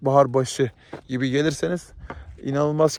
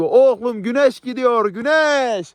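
lightly voiced famous singer, slow and a bit higher; 6 semitones higher, 3 dB louder, slower than usual. Vlogger quoting a famous singer at sunset, lightly mimicked. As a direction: quote the singer with a mild drawl. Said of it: "[name]'in dediği gibi".

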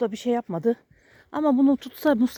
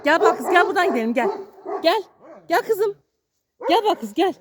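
high-pitched call to a pet; calling the dog; 7 semitones higher than usual. Real, not acted. Owner calls his dog to leave, high insistent calling.

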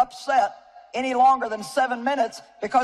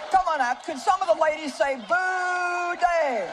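higher, rallying the crowd; calling out; 6 semitones higher, slower than usual. Real, not acted. Preacher urges ladies to boo Dave, calling out. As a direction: whip up the room to boo. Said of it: "calling out to the congregation".

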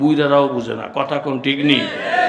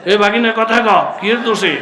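calling out; 7 semitones higher, 7 dB louder than usual. Real, not acted. Preacher demanding an answer from a nephew, calling out. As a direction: prod the listener for an answer, voice up.

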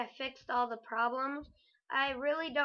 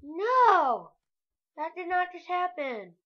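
higher, louder outraged disbelief; shocked disbelief; 6 semitones higher, 8 dB louder, slower than usual. Real, not acted. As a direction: protest in loud shock. Dying, disbelief at zombie.

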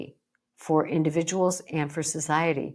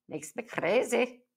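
jokey put-on Scottish accent, pitched higher; 5 semitones higher, 3 dB quieter than usual. Coach joking about diet hypocrisy, mock Scottish accent. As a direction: slip into a playful Scottish accent. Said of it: "that was my Scottish accent".

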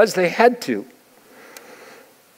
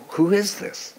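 hushed puzzled question; 3 semitones higher, 5 dB quieter, slower than usual. Retold or imitated. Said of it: "they conclude".

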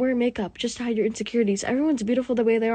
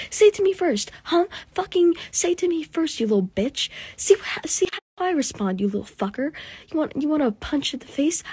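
higher, heated threats at the insulter; pissed off; 6 semitones higher than usual. Real, not acted. Telling off whoever insulted her friend, furious. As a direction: go for them, fuming. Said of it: "I'm pissed off and I'm gonna get mad".

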